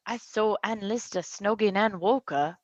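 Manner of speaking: put-on British accent, lightly. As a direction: quote her in a British accent. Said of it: "getting your British accent".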